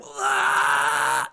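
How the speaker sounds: short high imitated scream; mock scream. Host acting out the bridge chaos behind them, mock scream. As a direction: yell out a cartoonish cry of panic. Mimics collapse behind movie characters.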